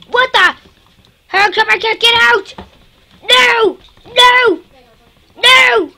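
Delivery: high loud panicked shrieks of no; panicked screaming. Real, not acted. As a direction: scream in terror as you're trapped. Trapped in game, panicking.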